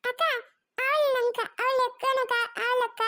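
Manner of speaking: put-on high-pitched child calling for big sister; whining little child. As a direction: whimper like a small boy pleading. Small child whines to sister.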